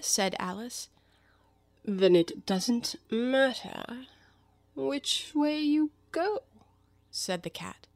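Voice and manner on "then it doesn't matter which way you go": languid cat voice, very slow; Cheshire Cat. Acted. The cat replies that any way will do, languid. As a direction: draw the words out, lifting higher at the end.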